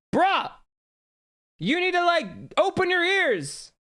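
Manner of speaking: high, loud, exasperated yell; exasperated shout. Real, not acted. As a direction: shout your frustration at the screen, dragging out the end. Complaining the AI voice talks too fast.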